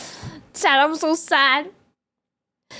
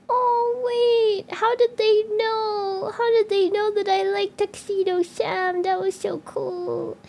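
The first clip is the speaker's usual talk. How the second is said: higher, slow, on the edge of tears; touched surprise; 7 semitones higher than usual. Retold or imitated. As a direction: relive the moved surprise, voice catching. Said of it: "And I was like".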